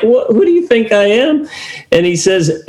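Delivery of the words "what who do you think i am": higher, disbelieving challenge; incredulous retort. Retold or imitated. Jesus answering the doubting father, incredulous and higher. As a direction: act amazed at the doubt. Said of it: "He's like".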